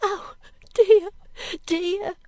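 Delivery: put-on high, loud, frightened girl's cry; crying out in distress. A narrator's reading. Girl remembering the burying ground, crying out in dread. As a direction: wail in rising panic. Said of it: "cried the girl".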